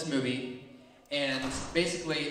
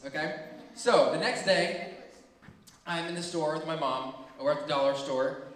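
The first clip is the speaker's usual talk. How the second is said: ordinary talk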